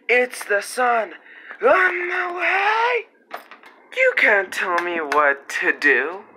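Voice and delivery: loud high toy voice. Pretend. Silly toy characters.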